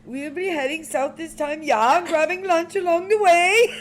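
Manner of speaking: hammed-up Swedish with the last words stretched slow; Swedish accent as Inga. Reading the ride plan aloud in a mock Swedish accent. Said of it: "Go ahead, Inga".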